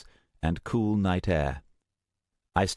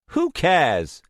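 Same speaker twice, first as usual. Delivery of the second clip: louder, scornful brush-off; irritated dismissal; 11 semitones higher, 6 dB louder than usual. Acted. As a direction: wave the question away. Dismissing Starbreeze.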